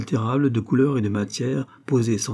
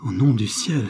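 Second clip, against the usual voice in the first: usual speaking voice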